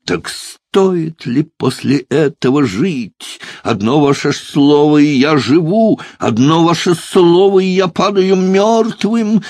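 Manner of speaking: false despair. A narrator's reading. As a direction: declaim like a ham tragedian, stretching the ends. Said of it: "С фальшивым отчаянием возопил молодой человек".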